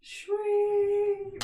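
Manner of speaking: high shwing sound again, drawn out; sparkle sound effect. Retold or imitated. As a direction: voice the magic glimmer. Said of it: "and they go".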